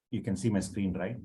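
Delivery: ordinary talk, nothing marked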